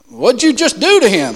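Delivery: loud, high, demanding cop; a policeman confronting the stranger; alarmed cop. Retold or imitated. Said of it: "the cops looking and said".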